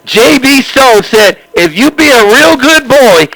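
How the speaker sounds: usual speaking voice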